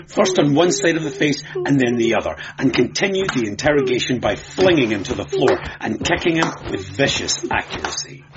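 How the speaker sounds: ordinary delivery, nothing marked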